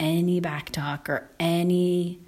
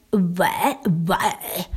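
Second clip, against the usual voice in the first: retold whisper. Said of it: "And she went".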